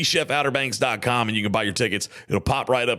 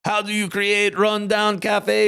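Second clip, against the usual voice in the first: lightly imitated Jamaican accent, slow and higher; a mock Jamaican pitchman asking, higher; 7 semitones higher, slower than usual. Host imitates an old Jamaican cafe commercial, mock accent. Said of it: "It's like".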